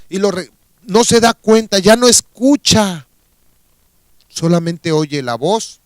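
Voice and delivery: ordinary talk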